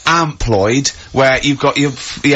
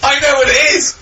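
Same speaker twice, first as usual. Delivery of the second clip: excited burst; 13 semitones higher than usual. Real, not acted. Excitedly guessing the answer.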